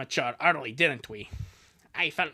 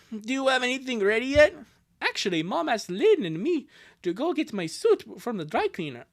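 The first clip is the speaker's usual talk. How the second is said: raised, animated cartoon dialogue voices; lightly acted sibling chat; 8 semitones higher, 5 dB louder than usual. Siblings chatting before an errand and goodbyes, animated. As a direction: bounce through a cartoon family goodbye.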